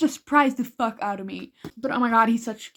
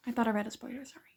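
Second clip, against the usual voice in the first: whispering; 11 dB quieter, much faster than usual. Real, not acted. Whispered confession of a spoiler.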